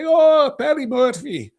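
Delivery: high-pitched, hammed-up mock Irish accent; bad Irish accent. Host mocking how he'd say an Irishman's name, bad Irish accent. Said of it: "I can't do an Irish accent".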